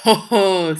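loud exclamation of astonishment; exclaiming. A narrator's reading. Minister reacting to the guest's incredible claim, exclaiming loudly. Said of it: "s'écria monsieur de Calonne".

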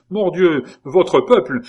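loud, high indignant oath; angry oath. A narrator's reading. Courtier furious at the king's people, loud oath. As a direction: spit out the curse in outrage. Courtier angrily recounts beating.

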